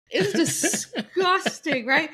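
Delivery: squealing it out through a laugh; disgusted giggle. Real, not acted. Laughing while retelling gross story.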